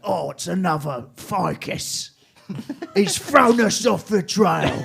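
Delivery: slow, put-on police voice; detective voice. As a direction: play the baffled detective. Cops voiced in a story.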